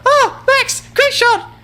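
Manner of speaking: very high squeaky praise; imitating a fan. Gamer mocks the praise his friend never gives, squeaky high voice. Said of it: "She doesn't go".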